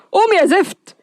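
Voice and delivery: high-pitched exasperated order; frantic, fed-up nagging; exasperated wake-up call. Acted. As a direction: pitch leaps up to rouse a sleeper. Frantically waking his sleeping brother.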